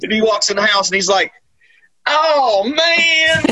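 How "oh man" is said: high, drawn-out imitated exclamation; mock exclamation. Movie character walks in the house, hammed-up high exclamation. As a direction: ham up a high-pitched reaction. Acts out man walking in house.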